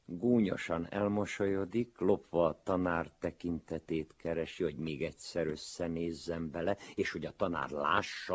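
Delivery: plain reading voice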